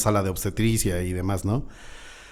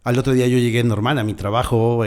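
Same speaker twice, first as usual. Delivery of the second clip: ordinary talk, nothing marked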